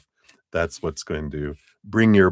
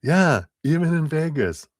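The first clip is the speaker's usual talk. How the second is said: Chuck; 10 semitones higher, 3 dB louder than usual. Acted. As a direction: chime in high, stretching the words out. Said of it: "That was probably Chuck going".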